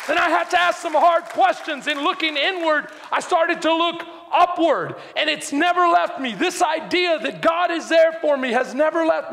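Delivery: impassioned preaching. Real, not acted. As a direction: preach it with urgency and conviction. Preaches at full force.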